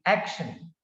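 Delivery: "action" with weight on AC; stress on first syllable. Shown as a demonstration. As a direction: lean on the start of "action". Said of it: "first syllable is stressed".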